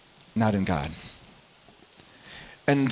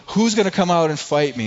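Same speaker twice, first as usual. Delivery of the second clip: a taunting giant's challenge, a touch raised; mock taunt; 6 semitones higher, 4 dB louder than usual. Preacher retelling Goliath's challenge to Israel's army, mock taunting. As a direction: jeer it like a bully calling out a foe. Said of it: "hears Goliath taunting and saying".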